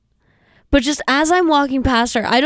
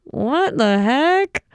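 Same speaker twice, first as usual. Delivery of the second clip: high, slow, drawn-out confused voice; mock bewildered onlooker; baffled crowd; 4 semitones higher, much slower than usual. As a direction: stretch it out like a stunned audience member. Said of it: "everybody else was sitting there like".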